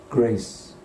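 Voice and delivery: ordinary talk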